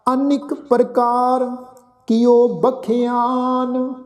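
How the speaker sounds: slow, drawn-out scripture chant; slow devotional recitation of the verse. Shown as a demonstration. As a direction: recite each word slowly and reverently.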